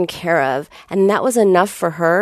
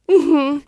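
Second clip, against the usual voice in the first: put-on child's sniffly gasp; 7 semitones higher than usual. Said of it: "And she's like".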